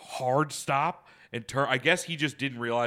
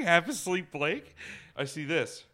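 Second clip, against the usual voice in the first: ordinary talk, nothing marked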